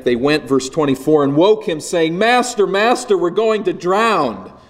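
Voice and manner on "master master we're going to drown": high, urgent plea drawn out on the last word; panicked cry. Acted. Disciples waking their sleeping master in the storm, panicked. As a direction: cry out in panic, pitched well up. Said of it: "in their panic".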